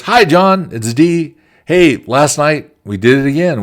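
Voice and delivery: high, loud, bright phone greeting; cheery coworker. Retold or imitated. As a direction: greet him sunnily as Dee. Dee calling him cheerfully again.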